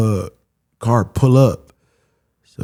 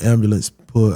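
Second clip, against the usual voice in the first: ordinary talk, nothing marked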